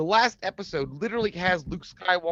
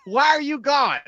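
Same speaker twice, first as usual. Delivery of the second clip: high loud whine; 10 semitones higher, 8 dB louder than usual. Retold or imitated.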